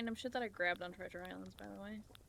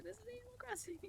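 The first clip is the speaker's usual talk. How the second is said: retold kid's excited shout; 12 semitones higher, 9 dB quieter, faster than usual.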